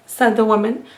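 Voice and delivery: usual speaking voice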